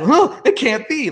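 high, hammed-up screwball lament; old-fashioned disbelief. Jerk dealing with unexpected mishap, old-fashioned mock dismay. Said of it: "it's so old-fashioned".

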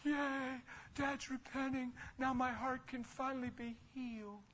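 sarcastic, hammed-up relief; mock gushing. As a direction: parody an overjoyed hurt son. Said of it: "I wasn't like".

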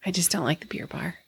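whispering; whispered confession. Real, not acted. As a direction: whisper it softly. Quiet confession aside.

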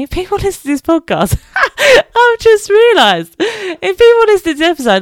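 high squealing laughter through the words; laughing hard. Real, not acted. Mother cracking up over her own podcast slip. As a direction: crack up at the realisation mid-sentence.

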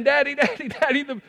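put-on high, frantic child's pleading; imitated frightened daughter. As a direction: act out a little girl begging in panic. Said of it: "his daughter is saying".

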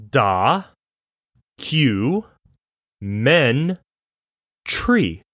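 "Da, Q, Men, Tree" each syllable spaced apart. Shown as a demonstration. Reading the Googled pronunciation to cohost, syllables separated. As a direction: spell out "Da, Q, Men, Tree" slowly, one syllable at a time. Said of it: "it's supposed to sound like this".

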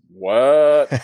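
loud, drawn-out stunned "what"; retold disbelief. Player reacting to a contract offer, acted astonishment. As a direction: act out being floored by the news.

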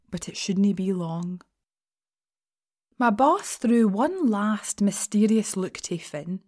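ordinary delivery

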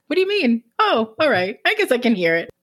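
high surprised question settling into concession; lightly acted confusion, pitch dropping; mock puzzlement. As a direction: act baffled, then grudgingly come round. Acting own reaction to accent remark.

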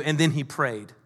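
normal talking voice

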